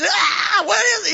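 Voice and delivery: high-pitched comic freak-out; mock scream. Mary screams as the angel shows up, hammed-up panic. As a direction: play a startled scream for laughs.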